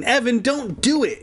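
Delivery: high-pitched, pleading protest. A narrator's reading. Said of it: "Andy protested".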